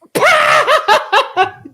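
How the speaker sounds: loud high burst of mock hysterical laughter; imitated roaring laughter. Movers in the store dying laughing, loud high imitated laughter. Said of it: "dying laughing".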